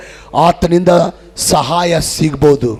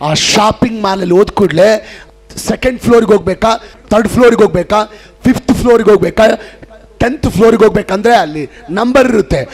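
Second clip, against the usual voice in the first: ordinary delivery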